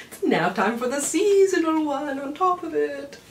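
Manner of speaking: mock villain announcement. Seasonal depression announces itself to the viewer, hammed-up gleeful. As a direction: announce your arrival like a cheerful villain.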